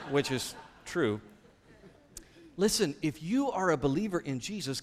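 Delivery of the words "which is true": laughing. Real, not acted. After laughing at own story.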